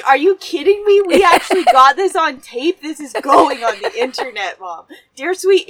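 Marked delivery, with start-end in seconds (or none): 0.1-2.8 loud, higher burst of disbelief; incredulous excitement. Real, not acted. Daughter to mother over scare caught on tape, incredulous. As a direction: exclaim over an unbelievable catch. Amazed strike was recorded.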